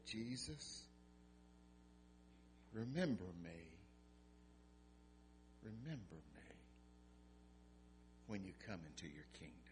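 soft, hushed pleading; dying criminal softly asking Jesus to remember him; quiet plea. Acted. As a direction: beg it gently, almost breaking.

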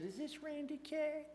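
high, slow and worried, like a caller with bad news; concerned lady's voice. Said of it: "concerned voice".

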